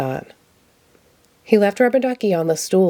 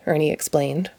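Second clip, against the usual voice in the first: plain reading voice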